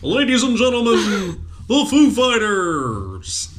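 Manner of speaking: high, slow TV announcer call; Don Pardo. Retold or imitated. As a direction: announce the band on live TV. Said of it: "That was my very worst Don Pardo".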